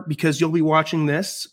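ordinary talk, nothing marked

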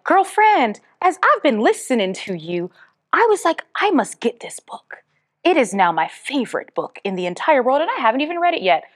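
gushing fan with a mock Southern twang; excited listener, playfully Southern; hammed-up Southern. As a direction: lightly put on a Southern girlfriend voice. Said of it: "I got really Southern all of a sudden".

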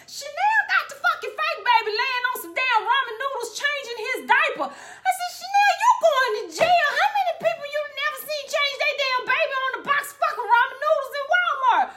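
high, loud laughing outrage; cracking up while scolding; laughing disbelief. Real, not acted.

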